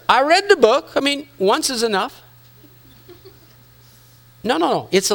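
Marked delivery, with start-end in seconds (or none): 0.1-2.2 higher, smug dismissal; mock smug brush-off. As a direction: play her know-it-all shrug. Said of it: "To her".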